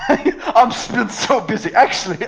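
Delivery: high, laughing, slow mockery; laughing tease. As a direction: poke fun through laughter. Teasing friend's claim of busyness.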